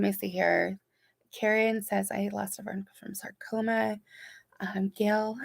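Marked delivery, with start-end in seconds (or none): 2.1-4.0 whispered, hushed reading; soft whisper. Real, not acted. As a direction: breathe the words out gently. Reading sad comment softly.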